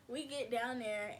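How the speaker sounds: ordinary delivery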